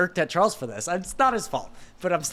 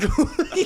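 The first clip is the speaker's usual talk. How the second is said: burst of laughter; 11 semitones higher than usual. Real, not acted. Laughing at absurd shoot.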